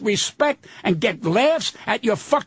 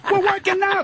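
high, exasperated protest; indignant retort; 9 semitones higher than usual. Retold or imitated. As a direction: push back, incredulous and high. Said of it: "he goes".

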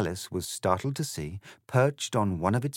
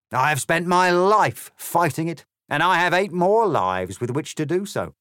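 loud, higher, rousing speech; ranting cat politician; 5 semitones higher, 6 dB louder than usual. Acted. The cat giving Alice a rousing anti-EU speech. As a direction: orate like a stump speech at a rally.

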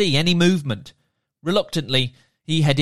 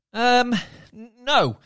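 put-on hesitant boy; 8 semitones higher than usual. Sammy answers nervously.

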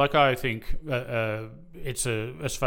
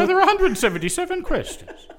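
put-on Irish accent. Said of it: "answer in your Irish accent".

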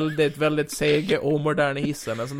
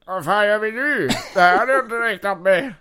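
higher, louder villain voice, startled; 7 semitones higher, 6 dB louder than usual. Acted. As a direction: play the stunned supervillain. GM voices Doctor Doom. (förvånad skurkröst)

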